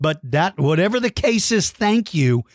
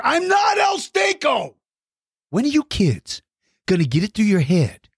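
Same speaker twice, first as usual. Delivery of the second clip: belligerent; 4 semitones higher than usual. Pretend. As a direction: bellow the denial in hammed-up fury. Mock outraged denial.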